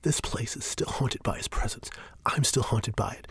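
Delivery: hushed, urgent whisper. Acted. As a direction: whisper so nobody overhears. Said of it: "Shane lowers his voice".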